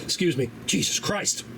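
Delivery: hiccup caught, then an under-breath curse. Real, not acted. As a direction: mutter after a hiccup. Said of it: "Got the hiccups I can't shake".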